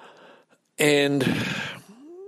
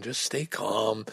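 slow, soft, soothing self-talk; soft self-calming; 3 semitones lower, 7 dB quieter, slower than usual. Retold or imitated. Man in a post office queue soothing himself, slow and soft. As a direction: talk yourself down gently and slowly.

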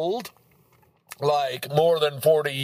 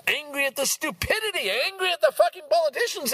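higher, rising anger at the politicians; angry rant; 11 semitones higher, faster than usual. Real, not acted. Vlogger ranting at politicians, real anger rising. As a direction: let the anger climb, pitch going up. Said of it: "makes you feel kinda angry".

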